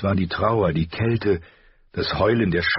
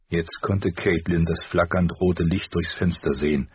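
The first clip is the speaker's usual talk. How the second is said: ordinary delivery, nothing marked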